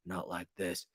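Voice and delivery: Brendan's hushed voice. Shown as a demonstration. Host mocking Brendan's late-night voice, deep and hushed. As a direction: drop it deep and hush it right down. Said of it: "You're talking like this".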